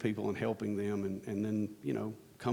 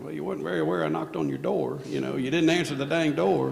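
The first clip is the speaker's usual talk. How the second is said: higher, louder sassy comeback; sassy retort; 6 semitones higher, 6 dB louder than usual. Retold or imitated. Visitor snaps back at the old veteran, higher and louder. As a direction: throw his words back at him. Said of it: "And I was like".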